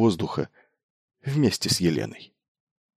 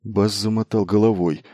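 ordinary delivery

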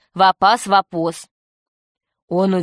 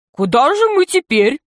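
high, questioning cartoon pirate; comic pirate; 6 semitones higher than usual. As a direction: ask the boss in a high comic voice. Весельчак У спрашивает Крыса. (высоким комическим голосом, вопросительно)

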